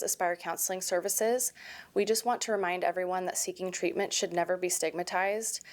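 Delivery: soft, measured sponsor message; understated podcast sponsor announcement; sponsor read. Real, not acted. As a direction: read the ad copy quietly and evenly.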